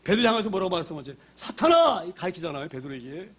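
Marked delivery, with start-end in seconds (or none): 1.3-2.0 high, raised cry of reprimand; fearsome rebuke. Acted. Jesus turning on beloved Peter, fearsome rebuke. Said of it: "무서운 말씀하십니다".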